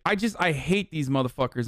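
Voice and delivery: ordinary talk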